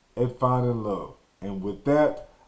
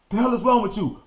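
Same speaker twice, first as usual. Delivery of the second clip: angry father; 8 semitones higher, faster than usual. Retold or imitated. Father yelling during whooping.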